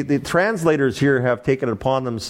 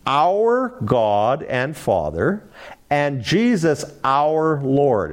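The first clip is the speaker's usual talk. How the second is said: slow deliberate reading; much slower than usual. Shown as a demonstration. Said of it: "It is".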